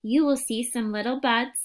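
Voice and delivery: put-on unhurried storytelling voice for a young child; picture book read-aloud. Teacher reading a picture book to a young child, gentle storytelling. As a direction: read it like a bedtime picture book, letting the pauses breathe.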